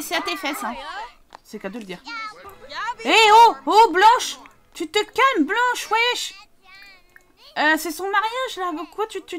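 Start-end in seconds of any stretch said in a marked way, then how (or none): 3.0-6.3 loud, high call to calm her down; shouting at Blanche. Real, not acted. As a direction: yell across to stop the fight. Calling out at angry Blanche. (crier fort pour calmer Blanche)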